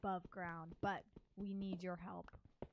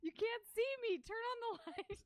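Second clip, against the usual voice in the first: very high pretend protest; high mock cry; 4 dB louder than usual. Invisible adventurer asking for the light, high mock-panicked cry. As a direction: squeal it playfully. Invisible player jokes as rats scream.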